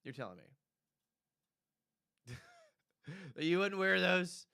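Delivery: drawn out very slowly in mock disbelief. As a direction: stretch "You're telling me" out, teasing.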